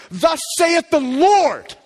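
booming prophet's pronouncement; prophetic declaration. Shown as a demonstration. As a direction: proclaim it like a prophet with authority. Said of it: "you feel that?".

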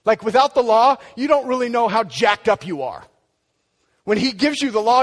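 loud, high, driving sermon voice; preaching at full force. Acted. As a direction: push it out, rising fervor.

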